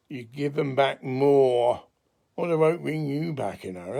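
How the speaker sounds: normal talking voice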